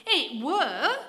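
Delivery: put-on a stubborn boy insisting to the vicar; undeterred. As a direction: stand firm like a stubborn child. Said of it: "said the boy, undeterred".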